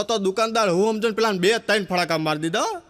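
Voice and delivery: ordinary talk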